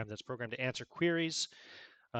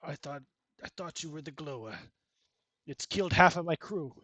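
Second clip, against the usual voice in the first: lightly voiced Ukrainian cosmonaut, remorseful; Ukrainian accent; 4 semitones higher, 8 dB louder than usual. Cosmonaut confesses firing on commander, a hint of Ukrainian accent. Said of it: "He says".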